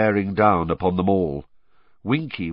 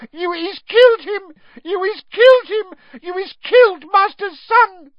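very high, loud, squeaky house-elf wail; piercing elf shriek; 10 dB louder than usual. A narrator's reading. Winky finding her young master on the floor, piercing squeaky shriek. Elf accusing over the body.

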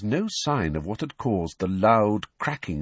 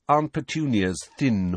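usual speaking voice

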